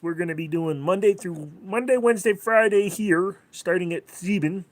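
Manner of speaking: mock New Yorker listing days; Queens accent. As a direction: streamer still in the Queens accent. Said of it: "talking like this".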